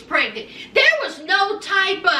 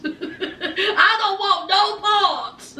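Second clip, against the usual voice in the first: laughing; 2 semitones higher, much slower than usual. Retold or imitated. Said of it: "that Henny artist was like".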